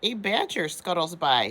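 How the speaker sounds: ordinary delivery, nothing marked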